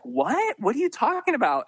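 high-pitched incredulous questioning; retold disbelief. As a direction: act out a baffled group demanding an explanation. Retold group challenges the driver.

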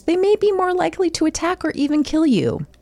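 ordinary delivery

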